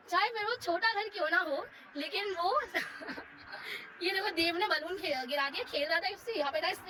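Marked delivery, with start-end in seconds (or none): 2.7-4.0 breathy laugh. Real, not acted. Laughs at son dropping balloon.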